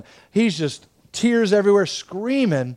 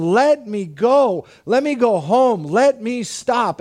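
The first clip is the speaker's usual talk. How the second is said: drawn-out, higher imitation of a begging child; retold screamed plea; 6 semitones higher, slower than usual. Son begging dad to release him, imitated pleading. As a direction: plead slowly like a distressed kid, short of screaming. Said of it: "because he is screaming, going".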